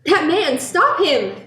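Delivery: loud, high alarmed call; alarmed cry to bystanders. Acted. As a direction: shout for someone to stop him. Calling out for help.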